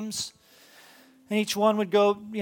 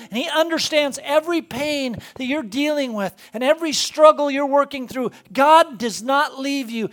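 louder and higher, building sermon fervor; preaching at full force; 5 semitones higher, 6 dB louder than usual. Acted. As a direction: preach it with rising conviction. Preaching climax about God.

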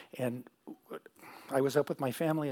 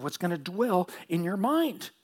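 ordinary talk, nothing marked

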